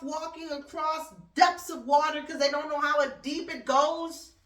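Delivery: high, loud, drawn-out outrage; indignant rising rant. Real, not acted. To her viewers, about border crossings, loud high outrage. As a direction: build the disbelief slowly and big.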